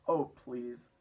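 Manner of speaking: loud, pleading call. A narrator's reading. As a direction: plead for help from up high. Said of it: "Miss Spider called out".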